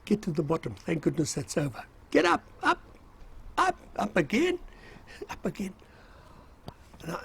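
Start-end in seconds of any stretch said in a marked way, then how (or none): 2.1-5.7 much higher put-on voice of an instructor calling "up, up"; retold instructor ordering the recruits up the mine dump again; imitated drill command. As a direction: squeak out the instructor's relentless order.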